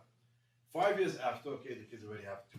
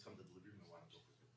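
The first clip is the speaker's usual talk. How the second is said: much softer, low murmur; hushed night talk; 6 semitones lower, 17 dB quieter, faster than usual. Retold or imitated. Father waking his son at two in the morning, hushed. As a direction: keep it down, everyone's asleep.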